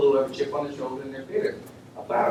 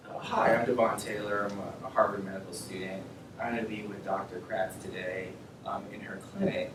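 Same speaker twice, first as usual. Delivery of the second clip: a softer, courteous self-introduction at the front desk; polite professional intro; 6 semitones lower, 11 dB quieter than usual. Shown as a demonstration. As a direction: say it politely and properly, like a well-mannered student. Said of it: "Just like that, that's the way I say it".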